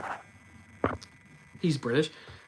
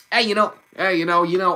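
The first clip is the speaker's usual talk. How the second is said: louder, lightly attempted Brooklyn accent; 3 semitones higher, 5 dB louder than usual. Shown as a demonstration. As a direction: try on a Brooklyn street accent. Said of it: "Do Sonic with a Brooklyn accent?".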